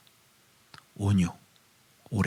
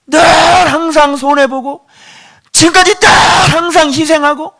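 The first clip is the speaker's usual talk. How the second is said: much higher and louder, slow at first; full-force preaching shout; 12 semitones higher, 7 dB louder than usual. Real, not acted. Preacher cries out about always being the one who endures, shouting. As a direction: cry out the burden of always yielding.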